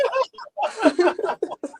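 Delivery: high bursting laugh; real laugh. Real, not acted. Laughing at her own timeout story.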